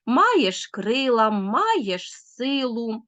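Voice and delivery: slow beat with first syllables of "маєш", "крила", "силу" stressed; trochaic scansion. Shown as a demonstration. Teacher demonstrating trochee, slow stress on first syllables of "маєш силу". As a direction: stretch the opening syllable of each word in "маєш крила, маєш силу". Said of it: "хорей наголошується на перший склад".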